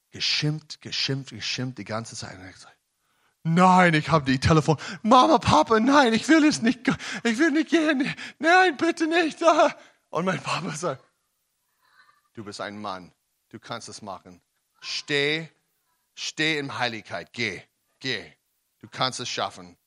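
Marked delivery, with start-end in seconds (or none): 3.5-9.4 high, rising mock-frightened plea; pleading boy. Thirteen-year-old begs his parents not to go, pleading. 12.3-19.8 softer, lower fatherly encouragement; steady him gently like a father; calm fatherly reassurance. Retold or imitated. Father reassures his scared son on the phone, softly.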